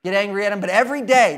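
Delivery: usual speaking voice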